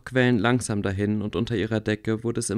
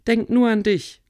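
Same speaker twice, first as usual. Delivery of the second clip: a much higher, louder frustrated cry; frustrated shout; 11 semitones higher, 6 dB louder than usual. A narrator's reading. Theresa yells at Tarsuin in frustration, much higher and louder. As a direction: yell it at him in exasperation. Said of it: "schrie Theresa frustriert".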